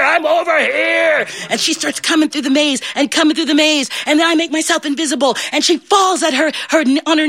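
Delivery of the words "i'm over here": slow, high call pretending to be Beast, drawn out; faked call for help. Fairy godmother faking Beast's call to guide Belle, slow and high. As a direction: stretch the cry so she can find it. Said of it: "So I have to fake it".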